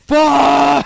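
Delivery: one long, high, blasting shout; hammed-up triumphant scream; imitated yell. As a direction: holler the curse at full volume. Imitating them yelling before kids.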